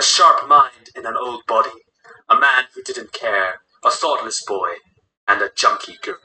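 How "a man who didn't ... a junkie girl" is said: ordinary delivery, nothing marked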